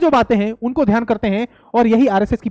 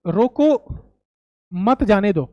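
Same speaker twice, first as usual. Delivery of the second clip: रोको alone, long pause, then a lower मत जाने दो; pause after रोको; 4 semitones lower, slower than usual. Shown as a demonstration. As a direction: hold a clear gap between रोको and मत. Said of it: "रोको के बाद कॉमा लगा दूं".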